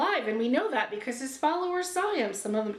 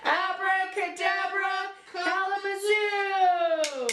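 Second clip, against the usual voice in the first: sung high and slow, stretched out; a grand, drawn-out magic spell; kids party magician; 6 semitones higher, slower than usual. Pretend. Magic trick incantation.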